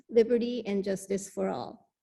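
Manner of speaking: usual speaking voice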